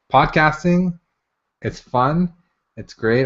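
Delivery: normal talking voice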